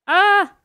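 sharp high yelp of fright; jump-scare shriek; startled scream. Real, not acted. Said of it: "Il m'a fait peur".

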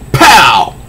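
punchy comic-book pow. Acted. Narrator punching out the sound effect, high and sharp. Reading comic fight aloud.

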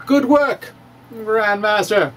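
ordinary talk, nothing marked

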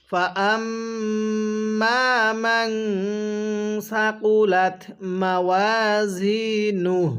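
very slow, drawn-out tilawat; slow recitation. Shown as a demonstration. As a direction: chant it slowly for students to repeat. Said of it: "আরেকবার আমার সাথে পড়ুন". (ধীর তিলাওয়াত)

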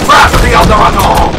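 loud attack call; battle cry. Pretend. Gamer unleashing an attack in a match, battle cry. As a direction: bellow it like a game villain's special move.

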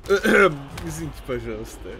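apologizing through nervous laughter; giggling nervously, trying to stop. Real, not acted. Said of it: "Это, это нервный смех".

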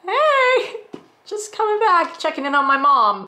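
put-on very high, light teenager voice; teen kid voice. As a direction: play the grown-up kid dropping in. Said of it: "And they're like".

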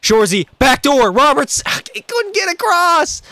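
high, loud excited calls to teammates; excited yelling. Real, not acted. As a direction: shout the play out, fired up. Yelling at teammates during gameplay.

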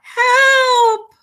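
loud, very high call for help; panicked cry. A narrator's reading. Froggy calling his mother, alarmed high cry. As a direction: yell it high and long. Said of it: "cried Froggy".

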